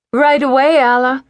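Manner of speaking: put-on cheerful young waitress calling across the counter; Dora the waitress. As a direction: chirp it back like an eager waitress. Dora the waitress answers brightly.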